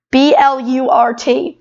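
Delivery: B L U R T spelled letter by letter, slowly; spelling it out. Shown as a demonstration. Girl spells the title for a confused host, slowly.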